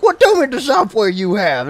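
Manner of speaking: high, loud mock challenge; imitated teenage kid. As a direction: mimic a scornful young gamer. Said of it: "the local thirteen kid".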